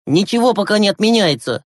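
lightly voiced boy leader, firm; firm older boy. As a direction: take charge of the little ones. Gleb overrules the panicking children.

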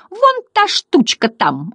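high, slow comic drawl; clown butterflies. A narrator's reading. Butterflies pointing out Bastian, high drawn-out voice.

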